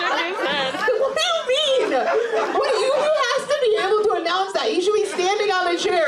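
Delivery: high-pitched, stretched-out disbelief; incredulous outburst. Real, not acted. Comic challenges an audience member's answer, incredulous outburst.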